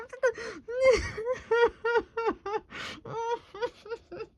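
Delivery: squeaky crying laugh; high helpless laugh; high wheezing laugh. Real, not acted. Reader at the message, high wheezing laughter.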